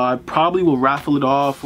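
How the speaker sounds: ordinary talk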